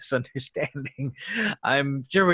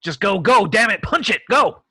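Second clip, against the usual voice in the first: louder, higher go-go shouts; frantic yelling; 7 semitones higher, 7 dB louder than usual. Acted. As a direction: scream the pilot into moving. Captain yells at pilot under fire.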